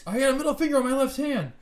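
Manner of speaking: shocked yell. Acted. Cohost discovering finger grew back, shouted.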